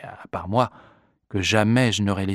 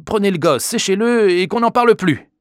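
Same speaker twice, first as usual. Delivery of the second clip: high, loud impatient outburst; exasperated; 10 semitones higher, 7 dB louder than usual. A narrator's reading. Father handing his son over to be rid of them, exasperated. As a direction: end the discussion forcefully. Father cuts the argument short.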